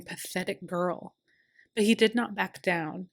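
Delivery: ordinary delivery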